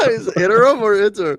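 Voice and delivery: loud, high question through laughter; laughing hard. Real, not acted. Co-hosts stuck on how interim is said, laughing loudly. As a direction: crack up while asking it.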